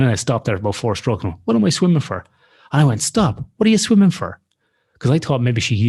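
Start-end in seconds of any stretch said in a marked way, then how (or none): 1.4-2.2 high, exasperated question to herself; stopping mid-panic, half laughing at herself; incredulous. Retold or imitated. Stops swimming, questions self. 3.0-4.4 high, loud call to stop. Retold or imitated. Calling to fleeing friend.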